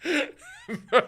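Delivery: real high laugh into the word. Real, not acted. Streamer finding the viewer hilarious, laughing helplessly.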